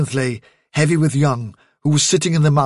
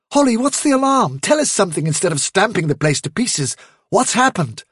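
urgent demand; 6 semitones higher than usual. Acted. Demanding news amid alarm.

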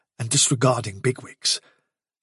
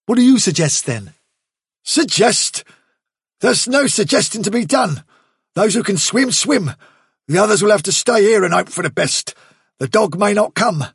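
higher, louder, curt and impatient; gruff rabbit giving orders; Bigwig's blunt voice; 7 semitones higher, 4 dB louder than usual. A narrator's reading. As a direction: lay down the rule like a hard soldier.

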